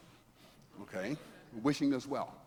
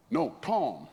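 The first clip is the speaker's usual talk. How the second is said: sharp raised insistence, higher and louder; insistent correction; 8 semitones higher, 7 dB louder than usual. Retold or imitated. Teammate insisting Bob is called Tom, loudly. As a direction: snap back the correction firmly.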